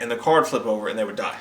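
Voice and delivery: ordinary talk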